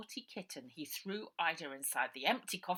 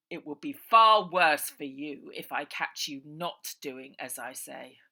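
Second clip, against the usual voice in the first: loud, slow villain threat; slow, loud menace; menacing threat; 12 dB louder than usual. Acted. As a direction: threaten the henchman coldly.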